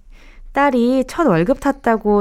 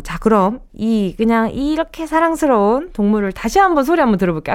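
ordinary delivery

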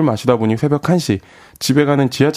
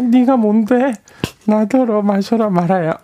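put-on high, giggling tipsy girl; squeaky tipsy woman; girl's voice; 9 semitones higher, slower than usual. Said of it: "여자 목소리를 낼 때".

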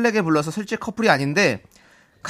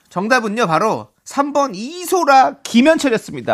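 정답 발표 말투; 8 semitones higher, 3 dB louder than usual. Acted.

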